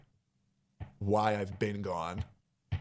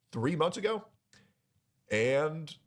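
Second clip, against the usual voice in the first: ordinary delivery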